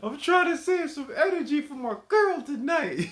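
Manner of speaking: high, laughing through the words; laughing quote. Retold or imitated. Streamer repeating the funny line through laughter. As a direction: squeal it out while cracking up.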